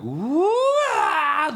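loud, very high rising clown whoop; Pennywise high whoop. Shown as a demonstration. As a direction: swoop up into a loud, high wail. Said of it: "the character does go".

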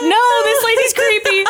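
high laughing mock line. Host voicing Colleen while laughing. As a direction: giggle it out.

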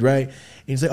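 usual speaking voice

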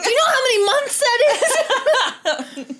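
high, slow, loud laughing emphasis; laughing rant. Real, not acted. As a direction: drive it home while laughing.

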